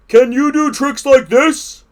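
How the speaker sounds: high, loud challenge; boastful cry. A narrator's reading. Toad shows Frog his high-wire tricks, loud boast. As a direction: show off loudly to a friend. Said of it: "cried Toad".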